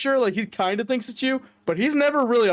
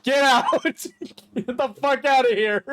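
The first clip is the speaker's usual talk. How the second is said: higher laughing get out; laughing protest; 6 semitones higher, slower than usual. Real, not acted. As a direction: shoo him off through laughter. Mock indignation at teasing.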